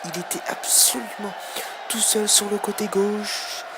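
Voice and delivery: loud, lively match wrap-up; excited sports commentary. Real, not acted. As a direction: keep the crowd fired up. (commentaire sportif enflammé)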